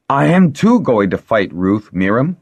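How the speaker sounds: stung, rising objection; indignant retort. A narrator's reading. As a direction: insist hotly on being contradicted.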